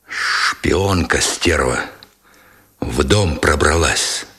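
low, slow husky accusation; hoarse wheeze. A narrator's reading. Sergeant accusing the German woman, low hoarse wheeze. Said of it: "просипел Меженин".

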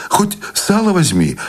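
high, flustered urging; startled fussing. A narrator's reading. Wife pressing food on her husband, startled fussing. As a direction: urge it on him, voice pitched right up. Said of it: "встрепенулась Анеля".

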